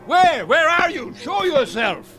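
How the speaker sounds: frantic shout. Acted. Captain searching for the hidden judge, frantic yell. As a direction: shout wildly into the dark.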